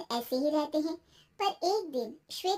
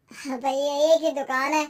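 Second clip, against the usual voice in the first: dismissive shop owner; 7 dB louder than usual. Acted. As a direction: refuse firmly as the shopkeeper.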